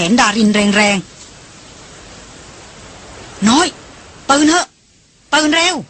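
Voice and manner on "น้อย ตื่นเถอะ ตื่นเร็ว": higher-pitched, pressing call; short urgent calls to wake someone. A narrator's reading. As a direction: rouse a sleeper, pitch lifted. Rousing a sleeper urgently.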